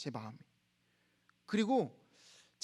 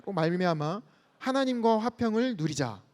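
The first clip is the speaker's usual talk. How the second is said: ordinary talk, nothing marked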